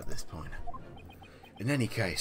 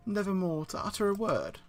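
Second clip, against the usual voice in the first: put-on theatrical old man; 10 semitones higher than usual. Voicing a dramatic old witness.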